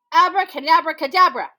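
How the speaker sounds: loud, ringing magic command; loud spell. Acted. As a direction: call out the spell loudly. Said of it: "he said".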